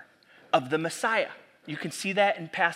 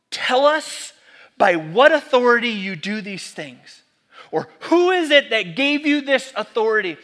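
higher, louder demanding question; indignant challenge; 4 semitones higher, 9 dB louder than usual. Retold or imitated. Elites confronting Jesus in the temple, demanding and louder. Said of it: "This is what they say".

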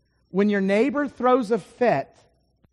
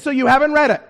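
louder, higher, pointed snark; snarky; 5 semitones higher, 8 dB louder, faster than usual. Retold or imitated. Preacher needling his seatmate about Galatians, snarky and louder. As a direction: needle him with a snarky follow-up. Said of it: "I start to get a little bit snarky now".

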